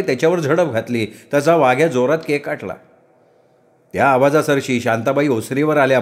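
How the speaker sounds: ordinary delivery, nothing marked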